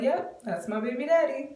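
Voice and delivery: high, playful mock-casual confirmation; re-enacted coy announcement; silly hinting. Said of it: "pretty much just doing it like that".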